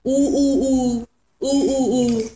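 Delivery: imitated monkey "ooh ooh" hoots; drawn-out playful monkey calls; monkey hooting. Shown as a demonstration. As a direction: hoot like a monkey for small children. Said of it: "میمون میگه".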